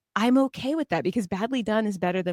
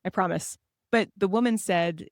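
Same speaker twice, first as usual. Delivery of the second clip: usual speaking voice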